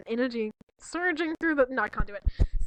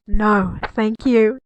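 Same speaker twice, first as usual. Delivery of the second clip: lightly deep Greeley line; 5 semitones lower, 7 dB louder, much slower than usual. Acted. Voicing Greeley, lower.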